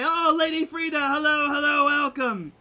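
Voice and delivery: high, loud, delighted welcome; excited greeting. Acted. Rescued dwarves hail Lady Frida on her return, excited greeting. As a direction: call it out high and happy, like hailing a rescuer.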